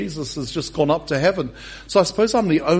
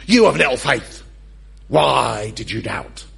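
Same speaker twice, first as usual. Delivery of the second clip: stern, louder rebuke of little faith; angry God voice; 3 semitones lower, 5 dB louder than usual. Shown as a demonstration. Jesus scolding Peter in the usual angry reading. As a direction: read it as a harsh scolding. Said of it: "give God an angry voice".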